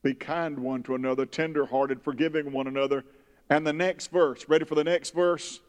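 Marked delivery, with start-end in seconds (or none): none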